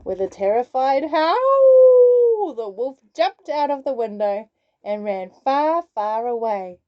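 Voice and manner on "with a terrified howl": dramatic. A narrator's reading. Dramatic narration of escape.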